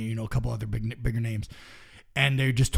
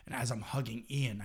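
ordinary talk, nothing marked

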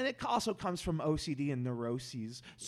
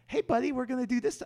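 higher, cheery talking-down voice; patronizing social worker; 9 semitones higher, 6 dB louder, faster than usual. Retold or imitated. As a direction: speak to him like a small child. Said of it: "be li-, be like".

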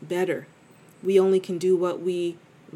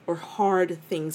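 usual speaking voice

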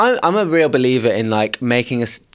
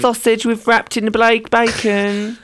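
camp voice; 9 semitones higher, slower than usual. Retold or imitated. Self quoted, camp.

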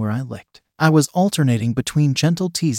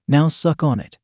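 ordinary delivery

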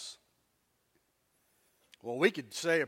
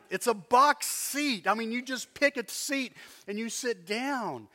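higher, indignant comeback; exasperated retort; 5 semitones higher, 3 dB louder than usual. Retold or imitated. Man to lady claiming his box seat, exasperated. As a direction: spell it out in mild exasperation.

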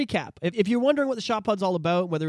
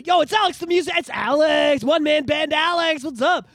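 hyped greeting; 9 semitones higher, 6 dB louder than usual. Retold or imitated. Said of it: "people be".

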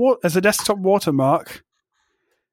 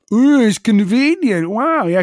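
mock-impressed, higher and louder; sarcastic gushing; 5 semitones higher, 4 dB louder than usual. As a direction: swoop up in fake delight. Mocking OneDrive's supposed convenience.

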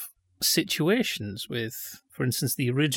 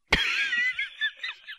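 laughing; 4 dB quieter than usual. Real, not acted. Laughs at being teased.

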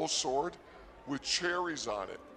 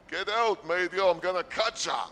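mock tough bouncer; 5 semitones higher, 5 dB louder than usual. Imagined bouncer threat.

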